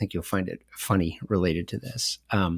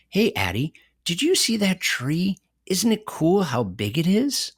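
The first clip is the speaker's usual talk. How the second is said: put-on high-pitched, wondering questions for a toddler; parent to toddler; 8 semitones higher than usual. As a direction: ask like a parent pointing things out to a little one. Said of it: "turn to your toddler in the back seat".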